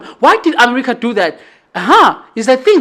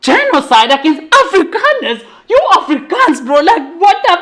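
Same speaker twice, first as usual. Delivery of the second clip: higher, laughing mock outrage; friends' disbelief; 6 semitones higher than usual. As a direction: play the friends baffled and amused. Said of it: "the guys are like".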